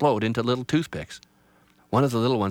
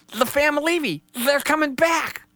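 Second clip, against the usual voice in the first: very high, jittery little rabbit; a scared bunny saying farewell; timid rabbit voice; 7 dB louder than usual. Acted. Rabbit says goodbye to Little Tree, very high and nervous.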